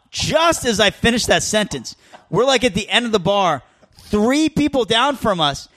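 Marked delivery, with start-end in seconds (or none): none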